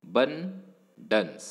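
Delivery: abundance said very slowly, weak a, bun stressed; teacher modelling abundance again; slow stress demo. Shown as a demonstration. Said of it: "very weakly uttered".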